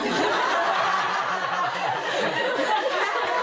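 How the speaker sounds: laughing loudly through the words; loud joyful laughter. Real, not acted. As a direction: let the joy spill out in laughter.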